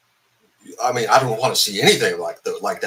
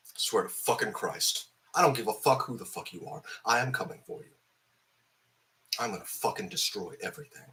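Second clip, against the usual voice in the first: quiet menace; 2 semitones lower, 9 dB quieter than usual. Real, not acted. Quiet threat to the characters.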